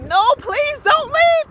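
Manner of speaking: high, loud, slow whiny begging; mock clingy plea. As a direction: ham up a desperate ex begging her to stay. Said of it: "I didn't cling on like".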